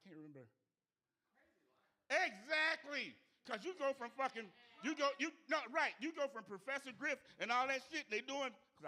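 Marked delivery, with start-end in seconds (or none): 2.1-8.5 loud, high, heated rant; excited shout. Real, not acted. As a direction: bellow it, fired up about the show. Excited rant at full volume.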